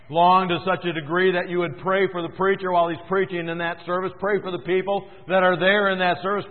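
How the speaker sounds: sermon pleading at full voice; fervent preaching. Acted. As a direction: press the congregation with growing fervor.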